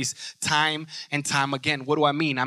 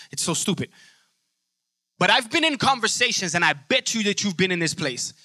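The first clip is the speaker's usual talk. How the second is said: usual speaking voice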